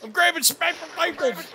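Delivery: high-pitched game trooper voice; gung-ho soldier. Acted. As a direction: show off to the squad like an eager soldier. Plays soldier while showing loot.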